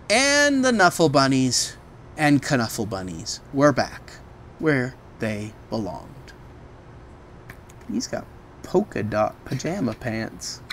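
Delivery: plain reading voice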